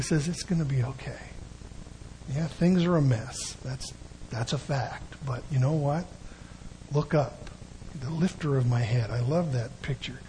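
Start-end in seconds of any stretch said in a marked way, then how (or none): 0.3-9.1 soft, gentle reassurance from a smiling father, much quieter; tender, hushed father's comfort. Retold or imitated. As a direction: quietly comfort a scared child who made a mess. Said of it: "a smiling Father who says".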